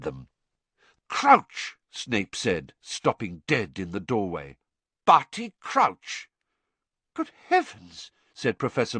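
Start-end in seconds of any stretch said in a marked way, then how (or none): 1.1-1.8 high, loud cry of recognition; shocked exclamation. A narrator's reading. Snape stops dead in the doorway, shocked exclamation. 5.0-6.3 high, loud and slow with disbelief; shocked exclamation. A narrator's reading. Snape recognizes the man on the floor, shocked exclamation. 7.1-8.1 very high-pitched astonishment; shocked exclamation. A narrator's reading. McGonagall sees the man on the floor, shocked exclamation.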